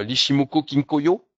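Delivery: playful put-on Japanese accent on the name Ishimoku Kinkoyo; mock Japanese accent. Shown as a demonstration. Webinar host naming a trading method, in a mock Japanese accent. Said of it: "excusez mon accent japonais".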